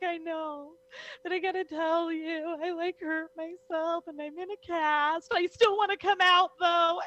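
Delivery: high-pitched tearful wailing; mock crying. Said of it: "cried on the phone to Paul Bear".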